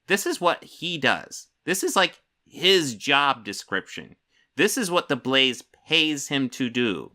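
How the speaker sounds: slow, each "is" pressed hard; slow emphatic insistence. Real, not acted. Streamer insisting to chat that this is his paid job, slow and emphatic. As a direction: spell it out word by word.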